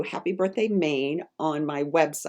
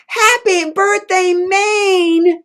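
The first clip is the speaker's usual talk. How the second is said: much higher, louder booming greeting; Paul Bunyan yelling birthday wishes, much higher; Paul Bunyan shout; 10 dB louder, much slower than usual. Acted. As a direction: burst out of the cake shouting. Paul Bunyan bursts from cake.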